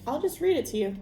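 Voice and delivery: ordinary delivery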